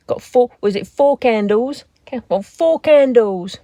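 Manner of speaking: hammed-up shopkeeper accent, badly done; mock Two Ronnies shop sketch accent; terrible accent. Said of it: "My terrible accent".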